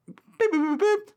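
high, loud chirpy robot beeping; robot beeps. Retold or imitated. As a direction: beep it out like a cartoon robot. Imitating robot noises.

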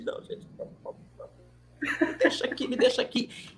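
whisper, shy and pleading; whispered refusal. Retold or imitated. A shy singer hiding from being called, whispering no. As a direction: hush them off, almost laughing.

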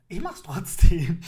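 loud, slow defiant declaration; defiant shout. Acted. Podcaster defying listeners about online dating, loud shout. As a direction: blast it out in defiance.

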